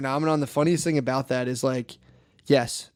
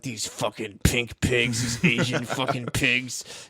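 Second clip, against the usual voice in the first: slow, lower sneering troll voice; 4 semitones lower, 4 dB quieter, much slower than usual. Retold or imitated. Mocking a subreddit troll, slow sneer. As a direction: drag it out like an online bigot. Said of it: "in a subreddit being like".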